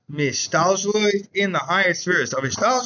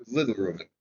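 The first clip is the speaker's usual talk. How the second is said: much lower and softer, made small; a small, tucked-in little Reuven; 7 semitones lower, 6 dB quieter than usual. Retold or imitated. Naming the two Reuvens.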